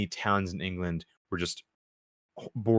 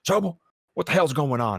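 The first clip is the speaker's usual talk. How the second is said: higher, louder commanding officer voice; imitating the major; 8 semitones higher, 4 dB louder than usual. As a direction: bark it like an impersonated major. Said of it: "He's like".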